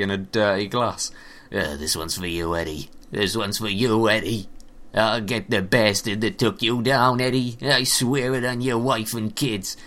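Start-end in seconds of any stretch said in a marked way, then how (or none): 1.5-9.8 noir detective. Pretend. Alone in his office over whiskey, a noir detective mourning Eddie. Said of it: "like Detective Noir novels".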